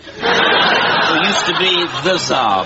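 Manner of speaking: "the Tsar" set apart and said deliberately; pointed correction. Acted. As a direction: correct him with weight on "the Tsar". Officer corrects the soldier's word bizarre.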